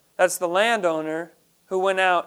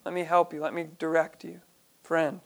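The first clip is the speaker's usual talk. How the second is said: softer, kind coaxing; gentle to a friend; 7 dB quieter than usual. Pretend. Talking a friend off the wrong path, soft and kind. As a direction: coax a wayward friend back, softly. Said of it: "Kinda like if you knew a friend".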